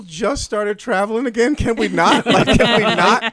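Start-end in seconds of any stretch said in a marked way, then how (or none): 1.6-3.3 exasperated. Real, not acted. Exasperated plea about air travel.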